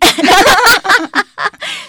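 loud high laugh. Real, not acted. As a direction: burst of high delighted laughter. Laughs at Taiwan Mandarin joke. (高声大笑)

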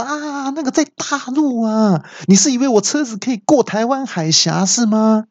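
拔高音调的夸张质问; 假装崩溃地拔高嗓门; 模仿大叫. Retold or imitated. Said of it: "那我听到之后就大叫说". (对导航机拔高嗓门夸张质问)

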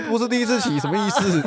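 nosy old man teasing a new rider, hammed up high; imitating the old man. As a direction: ham up a chatty old uncle. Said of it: "他说".

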